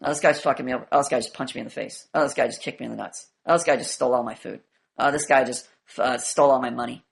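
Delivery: low, flat monotone with no feeling; drained, toneless complaining; demandless complaint. Shown as a demonstration. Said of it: "what, what was missing?".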